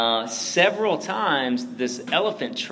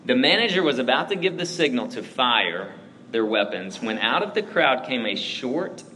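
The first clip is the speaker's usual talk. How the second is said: usual speaking voice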